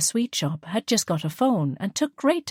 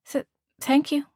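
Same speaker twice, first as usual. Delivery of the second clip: higher, nervous stammered thank you; stammering thanks; 9 semitones higher than usual. A narrator's reading. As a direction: caught off guard by the operator. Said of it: "Liesl stammered".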